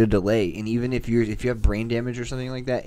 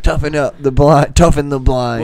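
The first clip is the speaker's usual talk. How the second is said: louder chant, a touch slow; mock drill sergeant; 4 semitones higher, 9 dB louder than usual. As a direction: bark it like a drill slogan. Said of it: "it's like".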